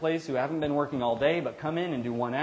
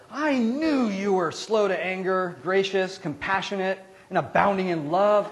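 higher, louder, slow, resentful complaint; indignant Jonah; 8 semitones higher, 6 dB louder than usual. Acted. Preacher voicing Jonah mad at God, loud and slow. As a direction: spit God's kindness back as an accusation.

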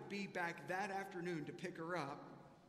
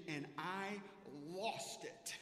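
usual speaking voice

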